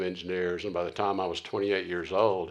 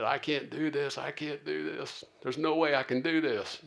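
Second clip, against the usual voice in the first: retold despairing plea, higher pitch; tearful protest; 6 semitones higher than usual. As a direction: act out a scout close to giving up. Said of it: "I am actually in tears, crying".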